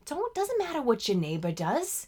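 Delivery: high-pitched, emphatic imitation of an older lady; imitated woman's advice. One of the women advising the group, imitated emphatically. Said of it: "one of the women was like".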